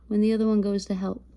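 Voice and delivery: usual speaking voice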